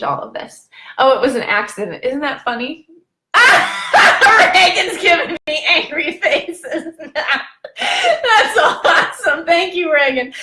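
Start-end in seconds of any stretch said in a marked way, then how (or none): 3.3-10.3 slow, high words through real laughter; delighted laughing aside. Real, not acted. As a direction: laugh while you say it. Laughing at viewer's reaction.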